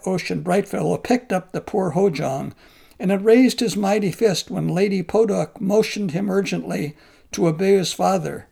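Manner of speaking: ordinary delivery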